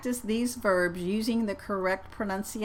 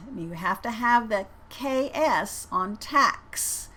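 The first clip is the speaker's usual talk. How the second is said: ordinary talk, nothing marked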